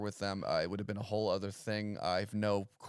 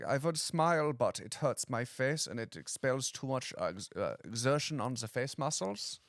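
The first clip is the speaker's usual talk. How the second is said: hammed-up German accent; mock German accent; 2 semitones higher than usual. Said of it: "Germany is America, but cleaner and no one smiles".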